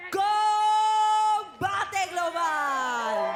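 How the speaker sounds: announcer shout. Real, not acted. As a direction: stretch it like a big build-up. Announcer builds up the main event.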